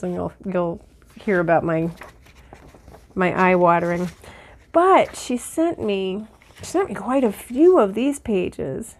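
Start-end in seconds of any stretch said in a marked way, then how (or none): none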